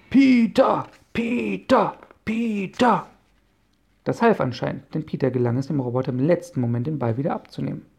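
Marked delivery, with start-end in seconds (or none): 0.1-3.1 loud, high cheering from the sidelines; cheering him on. Acted. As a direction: chant his name like fans in the stands. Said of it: "feuerten ihren Freund an".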